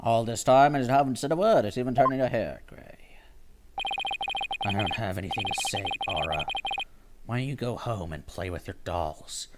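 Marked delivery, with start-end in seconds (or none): 1.6-9.5 low, soft contemptuous voice; cold low prisoner. Acted. Prisoner brushing off his sister, low and cold. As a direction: sneer quietly at her.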